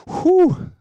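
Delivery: loud, high whoop of exertion; exhausted whew. Acted. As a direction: blow out a big whew of effort. Acting out sweating after intense practice.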